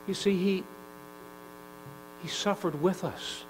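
ordinary delivery

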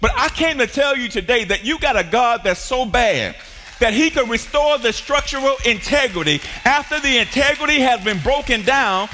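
usual speaking voice

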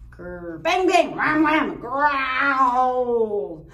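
loud booming bang and wham sounds; Wolf shouting the monster sounds; monster noises. Acted. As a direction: boom out the scary noises.

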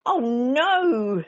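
loud, high exasperated groan; Mister Bear at wit's end; fed up. A narrator's reading. Mister Bear kept awake by Baby Bear, groaning loudly. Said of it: "said Mister Bear".

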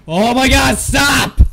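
loud, high cry; startled shout. Real, not acted. Player begging the monster to stop, loud startled shout. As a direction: shout it in sudden fright.

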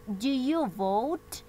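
very slow, drawn-out question; slow modeled question. Shown as a demonstration. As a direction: read it slowly for the class. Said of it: "This question says". (pregunta muy lenta y marcada)

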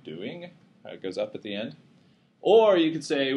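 usual speaking voice

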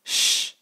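"sh" hissed with no voice; voiceless "sh"; unvoiced sh. Shown as a demonstration. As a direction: breathe out "sh" with no hum. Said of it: "Hear the difference between".

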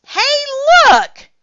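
high, loud attention-getting shout; excited yell. A narrator's reading. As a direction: call out urgently to a crowd. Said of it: "somebody shouted".

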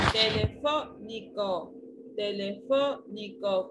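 "Telefónico" slowly split into syllables. Shown as a demonstration. Teacher giving Aldair the word, "Telefónico" syllable by syllable. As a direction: sound out "Telefónico" with breaks between syllables. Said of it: "¿Cuántas sílabas tiene telefónico?".

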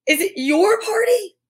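high, rising astonished question; crying out. A narrator's reading. Naughty finding the goblin at the party, crying out. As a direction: let the pitch climb in surprise. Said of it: "cried Naughty".

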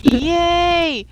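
loud high yay; cheering. Real, not acted. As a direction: cheer a small win. Celebrating in game.

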